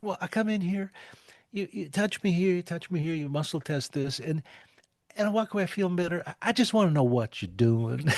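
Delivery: put-on a touch of a sweet old Southern lady asking; little old lady. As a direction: lightly mimic the puzzled church lady. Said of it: "this little old lady".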